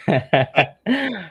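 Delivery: a quick high laugh; high amused laugh. Real, not acted. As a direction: laugh before starting to speak. [name] laughs before introducing himself.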